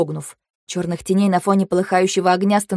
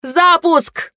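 building shout, rising in pitch; urgent shouted launch command; yelled command; 5 semitones higher, slower than usual. A narrator's reading. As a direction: yell the order, higher on the last word. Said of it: "орали мы вместе".

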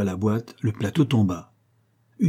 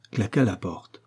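plain reading voice

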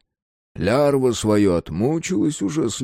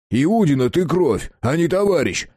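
angry accusation; 7 semitones higher, 4 dB louder than usual. A narrator's reading. Accusing a traitor.

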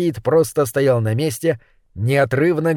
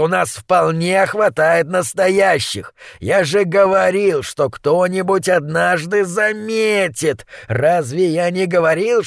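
slow, higher furious ratcatcher rant; bully scolding his dim partner; angry thug voice; 4 semitones higher, slower than usual. Acted.

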